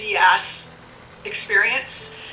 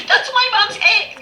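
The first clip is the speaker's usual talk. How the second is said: higher, louder, eager mock line; daughter's voice; 12 semitones higher, 4 dB louder than usual. Daughter telling strangers at the mall, high and eager. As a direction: announce it proudly like the daughter. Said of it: "She'll be like".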